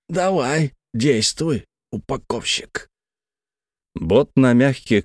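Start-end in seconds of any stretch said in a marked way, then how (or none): 0.1-2.9 stranger's taunting dare. A narrator's reading. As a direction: goad him in the stranger's put-on voice. Stranger dares the narrator.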